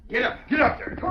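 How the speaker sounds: high call urging the horse on. Acted.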